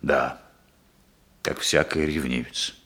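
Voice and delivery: low, heavy admission; dark, low-pitched confessing; sombre confession. Acted. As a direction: admit it in a sunken voice. Confesses jealous spying.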